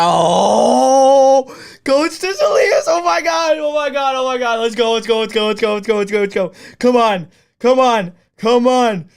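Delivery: high, loud celebratory screaming; ecstatic screaming. Real, not acted. Streamer erupting at the draft for his team, ecstatic screaming. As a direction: lose it completely, yelling in a frantic rush.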